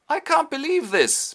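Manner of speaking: very high, loud disbelief; acted outrage. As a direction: protest in theatrical shock. Homburg told he is arrested.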